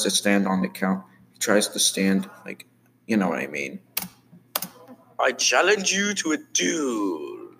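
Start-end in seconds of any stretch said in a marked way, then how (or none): 5.2-7.5 high, slow, drawn-out challenge; dramatic challenger. Acted. Character challenging a rival to a duel, dramatic. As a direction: declare it high and slowly, pitch falling away at the end.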